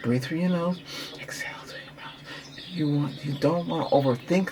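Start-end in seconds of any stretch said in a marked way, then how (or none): 1.2-2.7 very soft, unvoiced-sounding whisper; breathy whispered exhale. Shown as a demonstration. Coach demonstrating the breath out, whispering. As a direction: whisper it on a long outbreath.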